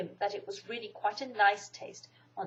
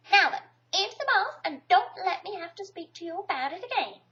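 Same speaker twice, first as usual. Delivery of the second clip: Kanga's voice; 7 semitones higher than usual. A narrator's reading. Kanga voiced in read story.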